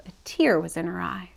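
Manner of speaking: plain reading voice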